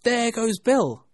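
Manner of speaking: high, loud, drawn-out exclamation; onlooker's cry. A narrator's reading. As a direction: call out as Bill flies past. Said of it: "was a lot of voices".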